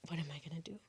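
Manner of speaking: barely voiced, frightened whisper; panicked whisper. Teller faces the beetle by the light switch, panicked whisper. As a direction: whisper it to yourself, at a loss.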